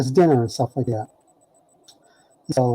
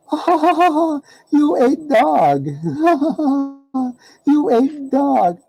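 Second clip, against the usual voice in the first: much higher, slow, cackling taunt; mocking cackle; 12 semitones higher, slower than usual. Faley taunts the teller that he ate the dog, cackling. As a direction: laugh in his face, drawn out.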